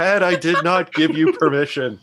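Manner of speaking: yelling. Pretend. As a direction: shout it at the voice in your head. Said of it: "And I'm just yelling".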